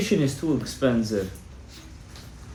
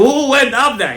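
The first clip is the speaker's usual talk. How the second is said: high, loud mock demand; insistent challenge; 12 semitones higher, 6 dB louder than usual. Owner pressing the rabbi once more, loud insistent imitation. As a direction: imitate a pushy question, pitched way up.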